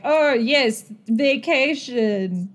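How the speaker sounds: hammed-up "oh, yes" with a long final word; mock agreement. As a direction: play along, stretching "vacation" out slowly. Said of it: "Because you are now like".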